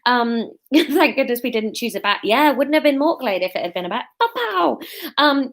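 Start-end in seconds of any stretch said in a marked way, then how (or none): 4.2-4.8 high-pitched playful pow-pow; comic pow noise. Pretend. Joking about the bat, high comic pow noise. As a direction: imitate a cartoon hit, pitched way up. Jokes with an imitated action noise.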